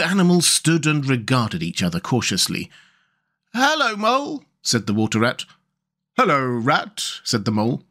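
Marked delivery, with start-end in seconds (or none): 3.5-4.4 high-pitched animal greeting; high Water Rat character voice. Acted. 6.2-7.0 reserved greeting, near usual pitch; Mole. Acted. The Mole answers Rat.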